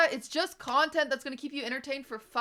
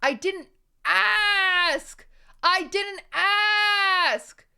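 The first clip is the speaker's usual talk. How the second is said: screamed, high, drawn out; exaggerated shouting cry; 5 semitones higher, 6 dB louder, much slower than usual. Real, not acted. Streamer screaming at chat that she didn't ask. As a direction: wail it like a tantrum.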